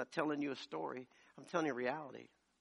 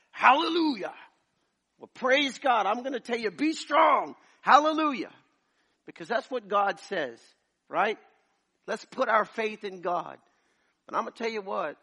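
high, loud, shouted preaching; a fiery preacher roaring hallelujah; full-force preaching; 8 semitones higher, 15 dB louder than usual. Acted. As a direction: lift the congregation with big shouts.

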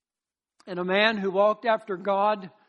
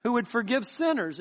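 ordinary talk, nothing marked

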